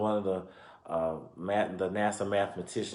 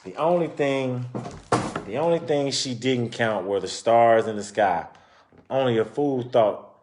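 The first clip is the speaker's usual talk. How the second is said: lively storybook narration; reading to children; 2 semitones higher, 6 dB louder than usual. Acted. Reading a children's book aloud to listeners, lively storytelling. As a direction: read it out like a picture book for kids.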